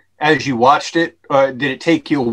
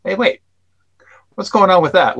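mock puzzlement, pitched up; puzzled audience member; 6 semitones higher, faster than usual. Filmmaker voicing an audience member getting clued in, mildly puzzled. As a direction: act out a viewer suddenly catching on.

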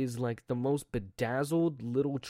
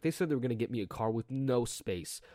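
ordinary talk, nothing marked

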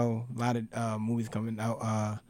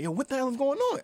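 high-pitched incredulous question; retold bewilderment; 11 semitones higher than usual. Man imitating his friend in the theater, bewildered. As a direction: act out a baffled friend, pitched way up. Acting out friend's confused reaction.

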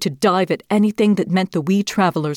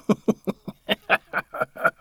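breathless fox snicker; 4 semitones higher, 4 dB quieter than usual. Acted. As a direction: sneer with a quick snicker. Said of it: "The fox snickered".

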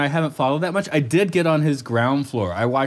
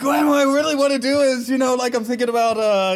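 rambling mock voice; 12 semitones higher than usual. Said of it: "It's like".

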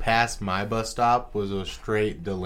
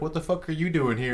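high startled demand; surprised question; 6 semitones higher than usual. Retold or imitated. Friend retelling meeting Dusty at the bus stop, high startled question. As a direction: ask in disbelief. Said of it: "I was like".

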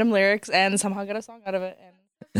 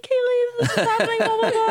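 high, laughing mock of a teary sister; imitating crying sister; 13 semitones higher than usual. Friend mimicking the sister crying on the phone, high and laughing. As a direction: pitch it way up like an overwhelmed sister through a laugh. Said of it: "Jenna's, like, crying on the phone".

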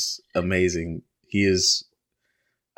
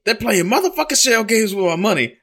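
much higher, louder furious dad; imitating dad angry; 12 semitones higher, 3 dB louder than usual. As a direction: shout it up high like the outraged dad. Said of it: "And he's like".

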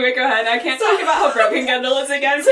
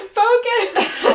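high-pitched yell breaking into laughter; mock yell; 13 semitones higher, 3 dB louder than usual. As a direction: imitate a sudden yelled order, pitched way up. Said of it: "he yells".

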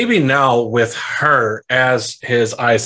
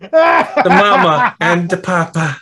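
put-on higher, slow silly accent; movie quote impression; 6 semitones higher, 4 dB louder, slower than usual. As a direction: parody the silly movie line. Master of Disguise quote.

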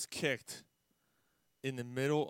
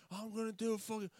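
high mock threat, trailing softer; hammed-up high, desperate resolve; imitated desperate man; 9 semitones higher, 4 dB quieter than usual. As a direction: act out a man at the edge, pitched up. Acting out suicidal man in skit.

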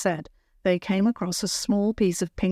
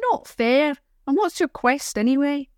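put-on sulky girl; 7 semitones higher than usual. Child sulking at visitors.

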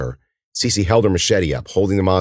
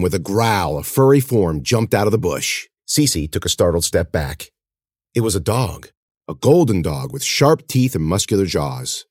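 ordinary delivery

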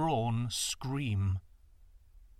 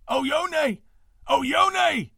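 high, loud desperate calls; calling out; 13 semitones higher, 11 dB louder than usual. A narrator's reading. Father calls his lost daughter through the bamboo, loud and high. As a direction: call her name across the grove. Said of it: "he called".

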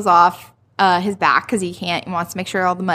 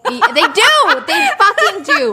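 high squealing laugh through the words; giddy laughing; 13 semitones higher, 5 dB louder, slower than usual. Real, not acted. Friend gushing about the couple, laughing high. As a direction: gush with delight, laughing.

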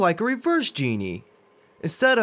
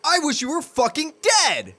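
furious outburst; 11 semitones higher than usual. Acted. Hunter lashes out at genie.

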